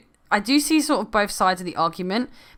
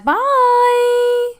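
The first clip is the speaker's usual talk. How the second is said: long, high, loud bye; drawn-out sign-off; 8 dB louder than usual. Real, not acted. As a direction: stretch BYE out on a high held note. Signing off to the viewers.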